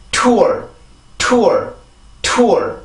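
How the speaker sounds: tour with blocked air released in a strong T; strong English T. Shown as a demonstration. Teacher showing how blocked air strengthens it, strong English T. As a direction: hold the T, then burst into falling tour. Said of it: "그래야지 소리가 강해집니다".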